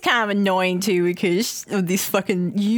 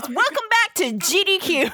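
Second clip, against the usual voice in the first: stream host intro; 8 semitones higher than usual. Retold or imitated. All you hear is.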